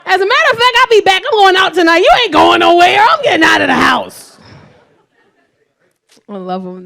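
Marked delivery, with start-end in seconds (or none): 0.0-4.4 loud, high mock yell; hammed-up defiant yelling; defiant shout. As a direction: shout it like storming out of the house. Defiant mother shouting at son.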